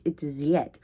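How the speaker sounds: "that" said as "zyet" in a Russian accent. Shown as a demonstration. Showing what replaces TH, "that" with a Russian Z. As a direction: turn the TH of "that" into a buzzing Z. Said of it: "instead of the T H".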